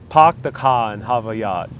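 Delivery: playful Boston accent with dropped r's; broad Boston "pahk the cah". Shown as a demonstration. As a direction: practice line in a Boston accent. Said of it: "the sentence you say in order to learn the Bo-, Boston accent".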